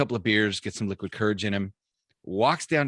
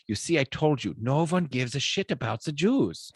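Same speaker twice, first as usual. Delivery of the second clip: put-on higher-pitched comic German accent; mock-German I-told-you-so; Adolf Hitler; 5 semitones higher than usual. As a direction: deliver the punchline as a vindicated German dictator. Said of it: "And Hitler looks at the bartender and he says".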